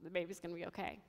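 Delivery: very soft, low and subdued; thinking to oneself. Retold or imitated. Mother telling herself about her baby, hushed inner voice. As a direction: murmur it privately to yourself. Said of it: "And I think to myself".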